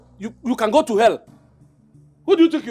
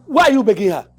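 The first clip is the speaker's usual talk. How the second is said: scolding; 6 semitones higher, 7 dB louder than usual. Acted. Scolding his friend.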